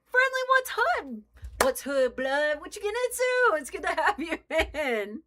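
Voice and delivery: very high, loud excited welcome; hyped greeting shout. Real, not acted. Streamer welcomes Friendly into the chat, high hyped shouting. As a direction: whoop out a hello to a buddy.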